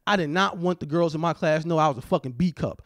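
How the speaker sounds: ordinary delivery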